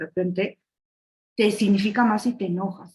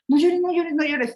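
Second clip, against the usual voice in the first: put-on loud, high repeated shushing of a child; hammed-up parent stopping a child's tears; scolding parent; 8 semitones higher, 5 dB louder than usual. Speaker mimics adults telling little kids not to cry, high and loud.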